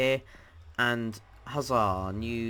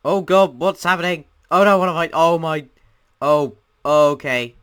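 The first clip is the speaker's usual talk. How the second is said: high, very loud alarm; panicked shouting; 6 semitones higher, 13 dB louder than usual. Real, not acted. Player watching the sand world collapse, panicked shouting. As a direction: yell in alarm as it all collapses.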